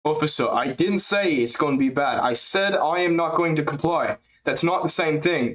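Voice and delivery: steady, firm, a touch foreign; improving DDP impression. Streamer retrying DDP impression, light European accent. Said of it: "we're getting better".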